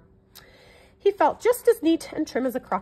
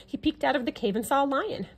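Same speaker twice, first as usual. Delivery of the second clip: usual speaking voice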